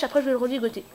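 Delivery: normal talking voice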